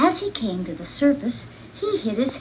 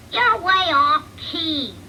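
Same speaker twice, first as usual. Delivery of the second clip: put-on chirpy baby birds; 6 semitones higher, slower than usual. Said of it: "said the birds".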